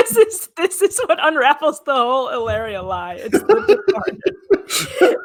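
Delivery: words spilling out through real laughter; giggling through the punchline; laughing while talking. Real, not acted. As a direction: laugh your way through it, stretching the last words. Laughing about the Departed clue.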